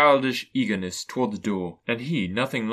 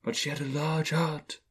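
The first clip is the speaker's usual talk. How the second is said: soft, higher-pitched spirit voice; gentle ghost; 4 semitones higher, 5 dB quieter than usual. A narrator's reading. Ghost praises the sister's heart, soft ghostly voice. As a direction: speak softly and high, like a spirit.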